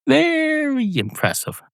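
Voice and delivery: very high, slow mock praise; mock impressed. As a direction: squeak it out in mock awe.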